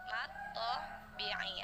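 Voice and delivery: ordinary talk, nothing marked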